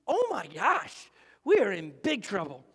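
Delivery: slow groaning "oh my gosh" then a weary sigh of doom; mock despair. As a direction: play the fed-up teacher. Jesus voiced, exasperated with disciples.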